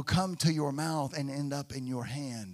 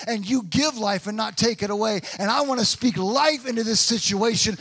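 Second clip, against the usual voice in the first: louder, rising sermon cadence; full-force preaching; 5 semitones higher, 6 dB louder than usual. Acted. Pastor urging the congregation to choose prayer, preaching loudly. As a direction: preach it hard, pressing the point home.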